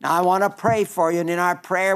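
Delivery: usual speaking voice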